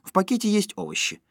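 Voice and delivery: high, energetic wifely voice; lively, decisive, pitched high; brisk dismissal. A narrator's reading. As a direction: sweep his doubts aside eagerly. Wife cheerfully overrides husband's doubts.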